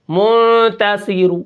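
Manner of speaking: منتصر said very slowly, final vowel only lightly voiced; faint final vowel. Shown as a demonstration. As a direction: stretch منتصر and let the last vowel barely sound. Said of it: "آواز بالکل خفیف سی". (منتصر بہت آہستہ، آخری حرکت ہلکی سی)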